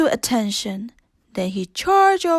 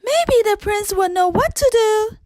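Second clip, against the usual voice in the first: put-on higher hopeful child-like voice; eager teacup; 9 semitones higher, 3 dB louder than usual. A narrator's reading. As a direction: suggest it brightly like a little kid. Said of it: "Chip suggested".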